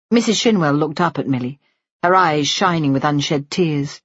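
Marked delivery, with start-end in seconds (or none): none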